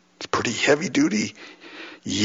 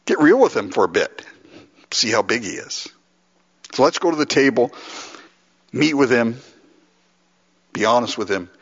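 ordinary delivery

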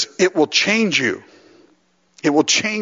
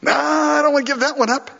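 reluctant refusal; 12 semitones higher than usual. Acted. Preacher voicing someone clinging to a habit, high reluctant whine. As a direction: play a stubborn holdout, pitched way up.

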